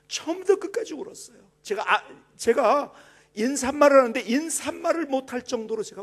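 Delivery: loud, high fervent testimony; impassioned preaching. Acted. Pastor confessing his coldness to the church, loud and fervent. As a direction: pour the confession out.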